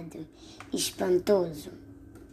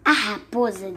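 usual speaking voice

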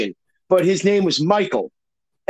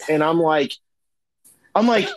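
usual speaking voice

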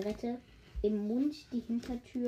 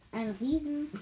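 ordinary delivery, nothing marked

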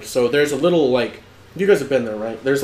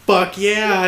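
higher, hyped-up imitation of a friend; mock gung-ho cheer; 7 semitones higher than usual. Friend answering the lady in the car, gung-ho. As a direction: whoop it like a party idiot.

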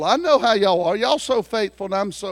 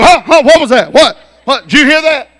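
loud, high, startled wife jolting awake; 8 semitones higher, 17 dB louder than usual. Retold or imitated. Husband imitating his startled wife hearing a noise. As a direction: jump awake and demand what that noise was.

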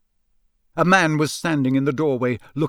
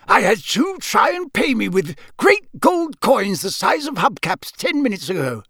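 high, animated local campsite keeper; Mister Roberts; 7 semitones higher than usual. Acted. Campsite keeper telling of odd payers, high-pitched. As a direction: recount it in a high, incredulous character voice.

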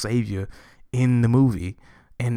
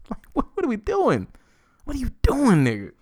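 high, disbelieving "what are you doing"; high-pitched incredulous whine; exasperated; 13 semitones higher than usual. Real, not acted. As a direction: throw your hands up in disbelief. Exasperated rant at Tyler.

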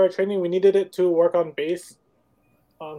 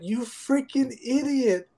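slow, higher, disbelieving put-down; mock exasperated scolding; 6 semitones higher, slower than usual. Retelling how the crew scolded their coworker, slow and mocking. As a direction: drag it out like talking to a fool.

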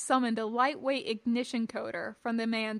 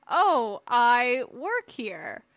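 slow, raised, over-innocent answer; nervous liar; 7 dB louder, much slower than usual. A narrator's reading. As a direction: stall as you bluff an authority. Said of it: "the unfortunate being lied".

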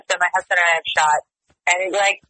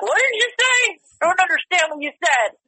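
mock-baffled retort pitched way up; high, exaggerated incomprehension, playfully needling; trash-talk banter; 9 semitones higher than usual. Ribbing a Swiss sparring buddy on the mats, mock-baffled. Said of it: "talk smack to each other".

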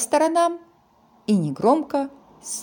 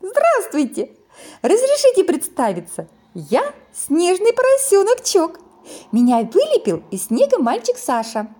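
high, loud, cheerful little piglet; 9 semitones higher, 5 dB louder than usual. Acted. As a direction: chirp it out like a happy piglet. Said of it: "Поросёнок весело хрюкнул и сказал".

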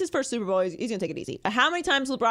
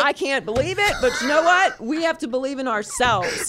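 louder, higher laughing pep talk; mock pep talk; 5 semitones higher, 5 dB louder than usual. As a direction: fire up the team, half joking. Voicing Patriots motivation speech.

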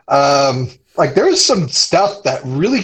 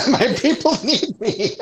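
laughing imitation; 5 semitones higher than usual. Echo of tabaxi line.

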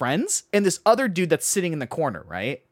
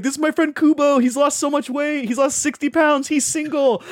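high, excited showing-off; hype-man intro; 9 semitones higher than usual. Retold or imitated. Friend hyping him up to strangers, high excited pitch. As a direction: sell your friend to the whole room.

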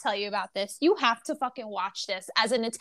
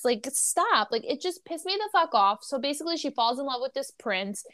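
ordinary talk

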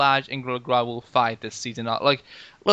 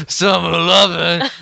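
slow, loud ape-like crooning; Gorilla voice; 4 semitones higher, 8 dB louder, slower than usual. Pretend. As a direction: sing it like a hulking gorilla. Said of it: "that's my Gorilla voice".

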